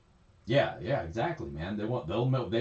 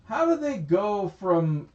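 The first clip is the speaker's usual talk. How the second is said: incredulous; 11 semitones higher, 4 dB louder, slower than usual. Real, not acted. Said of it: "What the fuck is that?".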